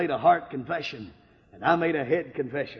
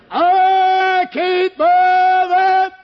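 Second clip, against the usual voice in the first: long, high, loud yell; slow, high, loud protest; 13 semitones higher, 10 dB louder, much slower than usual. Retold or imitated. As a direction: belt it out in defiance. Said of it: "and I stood up to my feet and I said".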